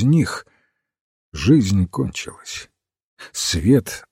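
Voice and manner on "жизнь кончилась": plain reading voice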